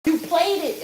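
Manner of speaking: high, loud, excited yelling; hollering. Retold or imitated. Aunt on the phone to her niece, hollering excitedly. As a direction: holler it like you can't believe your luck. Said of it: "And she hollered".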